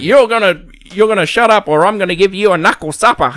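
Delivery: high, loud hammed-up threat; tough guy threat. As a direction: bark it like a hard ass boss. Quoting tough hospital boss.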